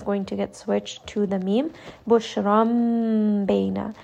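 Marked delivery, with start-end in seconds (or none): 2.1-3.9 very slow "Bushram bayna", the n turned to a held m; nasal m before ba; iqlab demo. Shown as a demonstration. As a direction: stretch "Bushram" and close the lips on the m before "bayna". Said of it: "instead of saying bushran bayna".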